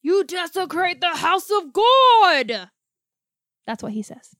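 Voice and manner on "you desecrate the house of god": high, loud, drawn-out mock thunder; outraged priest yelling. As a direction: bellow it slowly like a scandalized priest. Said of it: "like, yells at him".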